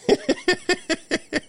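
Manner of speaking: short high laugh. Real, not acted. Vlogger laughing about young people worried about rent.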